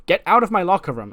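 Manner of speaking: loud, high-pitched acted order; indignant outburst. Tiffany ordering Mina out of her locker room, indignant outburst. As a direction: raise your voice to throw a rival out.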